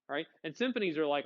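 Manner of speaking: usual speaking voice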